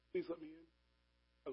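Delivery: slow it right down and hush it; hushed slow plea, almost a whisper. Acted. Jesus pleading at heart's door.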